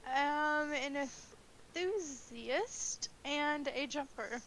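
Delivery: deliberate, stretched-out self-introduction; party guest in character. Acted. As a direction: draw each word out carefully. Player answers haltingly in character.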